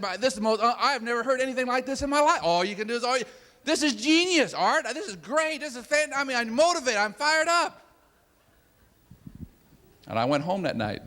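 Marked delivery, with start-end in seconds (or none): 0.0-7.8 high-pitched, gushing re-enactment of a thrilled fan; excited. Retold or imitated. Awestruck listener praising his mentor, mock excited. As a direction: play the fired-up convert, voice way up. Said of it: "I got excited".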